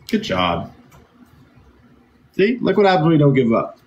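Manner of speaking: ordinary delivery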